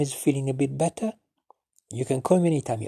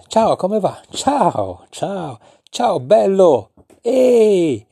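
higher and louder cheerful ciao; lively Italian greeting; 7 semitones higher, 5 dB louder, much slower than usual. Shown as a demonstration. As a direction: greet a friend across the street.

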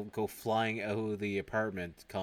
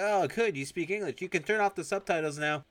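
high, louder mock-cheerful voice; sarcastic officer; 9 semitones higher, 5 dB louder than usual. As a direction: sell the sarcasm in a chirpy high voice. Officer mocks the suspect.

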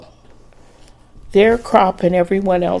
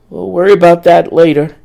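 insistent; 8 dB louder than usual. Acted. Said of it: "Brad insisted".

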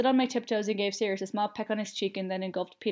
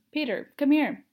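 loud, higher call down the road; yelling; 6 semitones higher, 6 dB louder, slower than usual. A narrator's reading. Sirius yelling down the road for Peter to come. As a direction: call him over from a distance. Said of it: "he yelled down the road".

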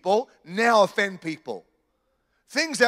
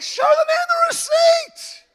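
very high, loud mock-exasperated yell; hammed-up high-pitched outburst; exasperated shout; 6 dB louder than usual. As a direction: holler it in comic disbelief. Exasperated retort to the offended woman.

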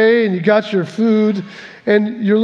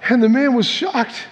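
ordinary talk, nothing marked

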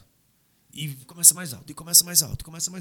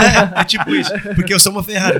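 louder laughing brag about being a Ferrari; 5 semitones higher, 11 dB louder than usual. Real, not acted. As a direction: laugh through the joke. Joking he's a Ferrari. (rindo alto, brincando que é uma Ferrari)

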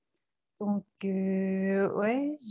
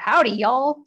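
put-on Texan accent; 4 semitones higher, 8 dB louder than usual. The host asks for a Texan accent, so she greets in Texan. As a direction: give it a big Texan howdy. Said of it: "faites-nous un peu de l'accent texan en anglais".

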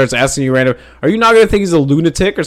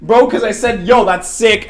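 mock panic; 10 semitones higher than usual. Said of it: "And I'm like".

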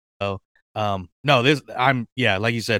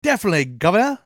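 put-on Cockney accent; 8 semitones higher, faster than usual. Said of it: "Cockney British accent".

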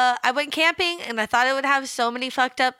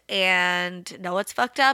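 ordinary talk

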